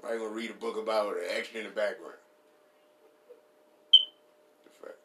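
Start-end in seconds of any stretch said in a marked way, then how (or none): none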